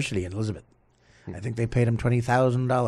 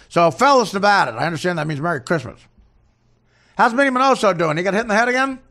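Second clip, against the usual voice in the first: higher-pitched, hammed-up coach caricature; Don Zimmer; 6 semitones higher, 4 dB louder than usual. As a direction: chat up an old teammate in a put-on high voice. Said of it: "it's Don Zimmer".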